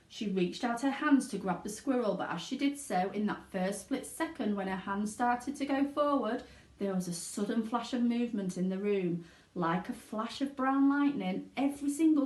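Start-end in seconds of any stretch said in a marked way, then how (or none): none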